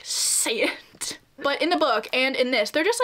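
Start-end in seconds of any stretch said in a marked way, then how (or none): 0.0-1.2 hushed, mock-intense whisper; imitated whisper. Retelling Edward in the forest scene, mock whisper. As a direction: whisper it softly, imitating a movie vampire.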